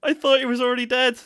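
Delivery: high and loud between laughs; laughing disbelief. Real, not acted. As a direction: exclaim it while cracking up. Repeats it while laughing.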